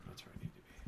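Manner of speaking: off mic. Real, not acted. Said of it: "I need to be here".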